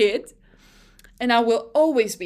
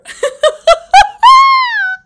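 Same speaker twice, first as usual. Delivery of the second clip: very high, very loud shrieking cry-laugh; totally losing it laughing; screaming laugh; 16 dB louder than usual. Real, not acted. Breakdown laughing at clip.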